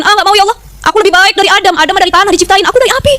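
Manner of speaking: high-pitched boastful protest as Iblis; defiant refusal. Acted. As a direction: refuse haughtily, pitch climbing at the end. Acting out Iblis refusing God's order. (menolak sombong dengan nada tinggi)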